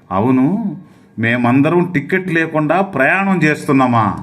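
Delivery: ordinary talk, nothing marked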